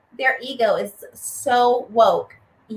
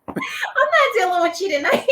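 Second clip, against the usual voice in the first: laughing; 8 semitones higher than usual. Real, not acted. Laughing at friend's tease.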